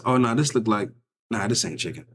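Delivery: ordinary talk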